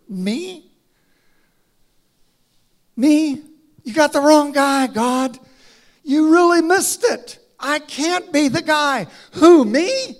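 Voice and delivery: high, loud disbelieving protest; incredulous Moses. Acted. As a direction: push back hard, you can't mean me. Moses protesting God's choice.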